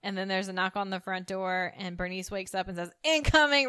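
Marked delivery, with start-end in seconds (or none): 3.0-3.7 loud sudden announcement of incoming; really loud. Retold or imitated. Woman waking up and yelling a warning, loud. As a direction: bark out incoming loud, startled awake. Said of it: "really loud".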